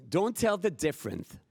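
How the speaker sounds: normal talking voice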